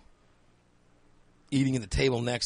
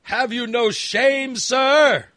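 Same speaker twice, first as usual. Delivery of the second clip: higher, louder, slow hammed-up scolding; mock outrage; 9 semitones higher, 8 dB louder, much slower than usual. Scolding the smelly friend, hammed-up loud mock outrage. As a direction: ham up the indignation, slowly and loudly.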